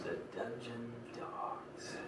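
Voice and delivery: slow, hushed, drawn-out reveal; ominous announcement. Acted. Villain showing the gathered crowd their enemy, ominous. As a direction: let the name hang quietly and slowly.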